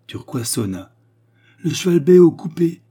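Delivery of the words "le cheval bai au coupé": loud, high-pitched call to a servant; raised voice, imperious; commanding order. A narrator's reading. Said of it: "ordonna-t-elle".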